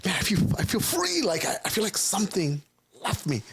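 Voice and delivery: overflowing with sudden joy and relief; chipper and happy. Retold or imitated. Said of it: "Now I'm, like, chippery".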